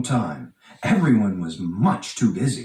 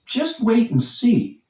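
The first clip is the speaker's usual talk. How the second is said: boastful tease; 10 semitones higher than usual. Acted. Thomas boasting to Percy.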